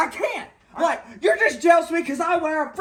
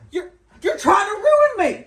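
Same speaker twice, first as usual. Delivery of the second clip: loud, high, laughing accusation; half-laugh outraged cry; laughing shout; 7 semitones higher, 5 dB louder than usual. Acted. To the coach over the clipboard, laughing shout.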